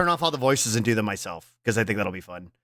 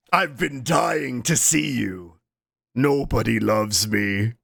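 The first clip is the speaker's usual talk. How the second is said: slow, drawn-out mournful quote; deadpan imitation, sinking low; imitated video character; 4 semitones lower, slower than usual. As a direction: stretch it out with mock gloom. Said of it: "He says, he goes".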